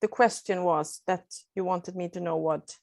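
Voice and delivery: normal talking voice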